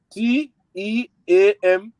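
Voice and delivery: spelled again slowly, letter by letter; slow down and spell it again clearly; slow repeat. Shown as a demonstration. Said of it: "Okay, again".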